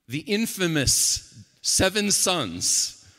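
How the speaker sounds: high, loud comic exasperation from the pulpit; loud, high, incredulous storytelling; animated preaching. Real, not acted. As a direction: belt out the story, amused and worked up. Preaches at full force.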